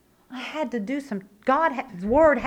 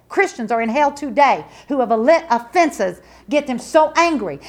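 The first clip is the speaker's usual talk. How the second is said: rising, louder preacher's warning; preaching at full force; 3 semitones higher, 4 dB louder than usual. Acted. Preacher warning the church, rising to a shout. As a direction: build the sermon to a roar.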